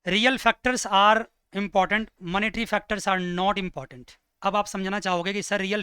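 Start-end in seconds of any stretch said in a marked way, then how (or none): 0.1-4.1 very slow, spaced words; teacher dictating the point slowly; slow dictation. Shown as a demonstration. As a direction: dictate it word by word. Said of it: "recap dictation".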